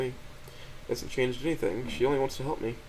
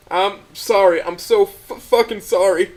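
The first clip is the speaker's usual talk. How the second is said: loud, higher, crying apology; bursting into tears at last; loud sobbing cry; breaking down sobbing; 9 semitones higher, 11 dB louder, slower than usual. A narrator's reading. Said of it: "Tears begin to flow from my eyes".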